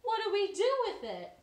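gasp, then high, loud, rushed questions; amazed and eager; surprised gasp. Acted. Jessica learning it's an egg, gasping and eager. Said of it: "And Jessica said".

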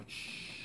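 short hiss for silence; shushing. Real, not acted. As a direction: hush the room. (siseo para callar)

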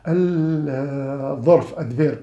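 "الظرف adverb" said very slowly and drawn out; slow emphatic term. Shown as a demonstration. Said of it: "نحصل على". ("الظرف adverb" ببطء وتشديد)

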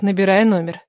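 plain reading voice

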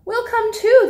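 high, loud and eager; eager disciples. Acted. Disciples eagerly tell Peter they will come, high and loud. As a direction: chime in like a cheerful group of friends.

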